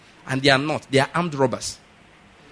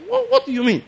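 high squeak of disbelief; sudden high-pitched what, what; shocked disbelief. Retold or imitated. Pastor reacting to the man helping politicians, squeaky disbelief.

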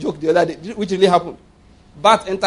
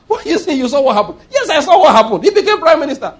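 louder, higher, laughing back-and-forth; laughing mock exchange; 8 semitones higher, 5 dB louder, faster than usual. Preacher mocking misreading of Joseph, laughing loud. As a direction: laugh as you argue both sides.

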